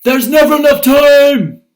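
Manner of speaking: very high, full-out yell; loud, high-pitched exclamation; exclaimed yell. Shown as a demonstration. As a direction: shout it like it ends in an exclamation mark. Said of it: "Did she say it like this?".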